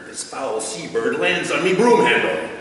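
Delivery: ordinary talk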